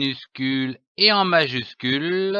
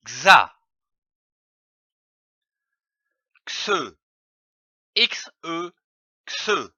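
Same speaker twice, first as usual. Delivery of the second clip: syllabic reading; slower than usual. Shown as a demonstration. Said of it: "Je lis une syllabe".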